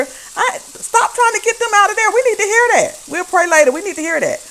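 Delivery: high, loud calling out from the pew; mock church shout. Imitating a churchgoer crying out, high and loud. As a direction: cry out to the ushers to let her stay.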